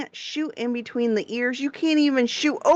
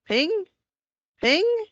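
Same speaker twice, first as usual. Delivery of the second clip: rising pings; 9 semitones higher than usual. Retold or imitated. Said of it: "So then you're like".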